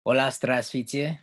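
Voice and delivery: ordinary talk, nothing marked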